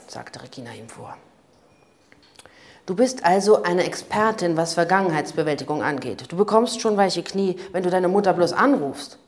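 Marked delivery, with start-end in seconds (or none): 2.9-9.2 loud, biting counterattack; angry retort. A narrator's reading. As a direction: snap back at her, louder than the narration. Streit im Auto, Cavalli kontert. (laut und gereizt, scharf zurückgegeben)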